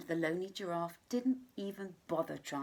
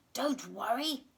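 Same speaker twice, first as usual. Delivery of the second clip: alligator voice; 4 semitones higher than usual. Acted. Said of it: "said the alligator".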